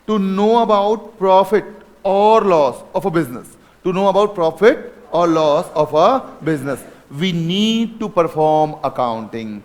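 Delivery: very slow, word by word, reading from the board; slow dictation. Shown as a demonstration. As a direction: dictate it so they can write. Dictating written line to class. (धीरे-धीरे लिखवाते हुए)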